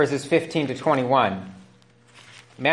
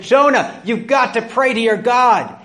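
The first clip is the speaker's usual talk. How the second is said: urgent sailors; 6 semitones higher, 4 dB louder than usual. Acted. Sailors urge Jonah to pray.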